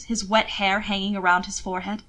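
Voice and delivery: usual speaking voice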